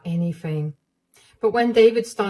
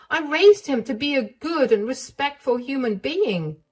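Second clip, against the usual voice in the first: higher, indignant motherly voice; higher, stunned then indignant voice; outraged mother-in-law; 9 semitones higher, 4 dB louder than usual. Acted. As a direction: let the shock turn to anger.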